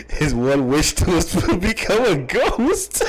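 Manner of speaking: can barely get it out for laughing. Real, not acted. Laughs mid-joke.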